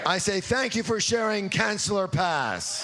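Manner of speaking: loud declaration. Shown as a demonstration. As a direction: shout it out, strong and high. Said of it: "please stand up for the declaration".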